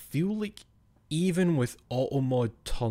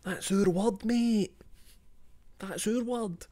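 high put-on English voice, our word stressed; high-pitched English impression; mock English accent; 11 semitones higher, slower than usual. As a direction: play a prissy Englishman claiming the word. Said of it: "the person ... was English".

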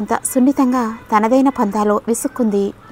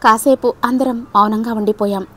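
usual speaking voice

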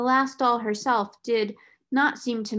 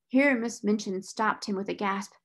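usual speaking voice

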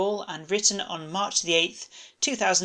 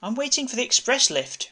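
Rosette's voice; 7 semitones higher than usual. A narrator's reading. Rosette voiced, higher than narrator.

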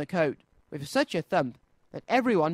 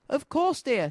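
kindly mother; 9 semitones higher than usual. A narrator's reading. Mother Kanga grants Tigger's request.

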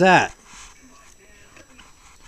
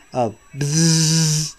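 bee buzz. Acted. Bee buzz in bedtime story.

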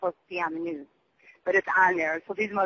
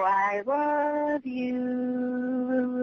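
drawn-out I love you, very slow and tender; loving demo; 4 semitones higher, much slower than usual. Shown as a demonstration. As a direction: stretch every word of it out, gently. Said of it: "like that in their mind or out loud".

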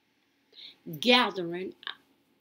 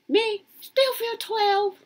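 pitched up high and drawn out slowly; sing-song it up high like a kid; high, slow childlike refrain; 10 semitones higher, 7 dB louder, slower than usual. Acted. Refrain about feeling twelve.

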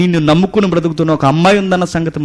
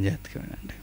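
whispered, much softer call to listen; hushed aside; 5 semitones lower, 17 dB quieter, faster than usual. Acted. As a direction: lean in and whisper it to the crowd. (గుసగుసగా జాగ్రత్త చెప్పడం)

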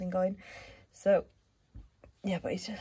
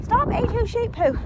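high, loud sharp call; calling out sharply; 13 semitones higher, 7 dB louder than usual. Real, not acted. Owner calls out to stop her dog eating poo, sharp call.

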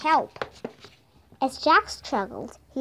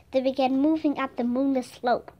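usual speaking voice

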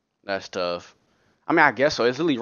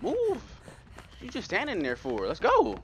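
shouting at game; 9 semitones higher, 5 dB quieter than usual. Real, not acted. Yelling at game character.

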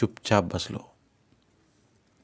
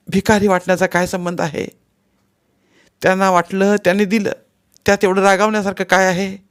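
high, louder protesting questions; exasperated; 9 semitones higher, 5 dB louder than usual. A narrator's reading. Wife questioning her husband's anger, exasperated. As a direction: argue back impatiently.

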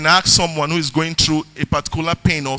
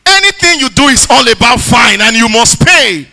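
raised, loud preacher's voice driving the sermon; preaching; 5 semitones higher, 5 dB louder than usual. Acted. Pastor preaching his testimony and scripture to the church, loud and fervent. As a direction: preach it full force to the congregation.

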